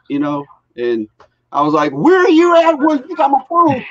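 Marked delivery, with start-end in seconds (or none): 1.9-3.8 high, louder, hammed-up frantic question; mock frantic. Dad calling the stranger holding his daughter's phone, hammed-up panic.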